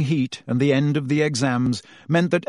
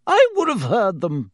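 bristling; 8 semitones higher, 4 dB louder than usual. Acted.

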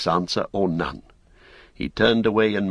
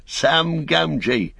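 called out high and slow, much higher; shouted greeting; 8 semitones higher, much slower than usual. A narrator's reading. Sam calling to the Cotton family at the door, slow high shout. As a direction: shout his name so they know him. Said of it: "shouted Sam".

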